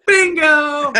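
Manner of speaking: Bingo shouted high and loud; elated Bingo cry; excited exclamation. Retold or imitated. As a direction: leap on it like you just struck gold. Excited discovery of song title.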